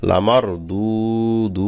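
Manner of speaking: "লামার" short, each "দু" held long; one-alif stretch. Shown as a demonstration. Teacher building the word for learners, long-held "দু, দু". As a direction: draw out the u of both "দু". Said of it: "এক আলিফ করে টান হবে".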